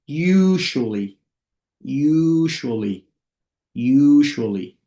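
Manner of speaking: long stretched first syllable, falling off; slow model pronunciation. Shown as a demonstration. English teacher models "usually" for beginners, slowly. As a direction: draw out "usually" for learners. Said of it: "lo voy a decir lento".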